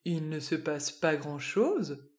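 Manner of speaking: low, soft questioning echo from a wise worm; wise old worm. A narrator's reading. The worm Home challenging Harry, low and soft. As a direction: gently question the boy's words, low and soft. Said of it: "affirme Home".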